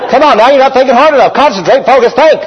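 playful impression of an impatient dad; pushy parent. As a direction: hurry a kid to think harder. Parent pushing a child.

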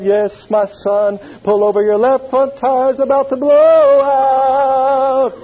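slow and grand, like God speaking; solemn voice of the Lord. Retold or imitated.

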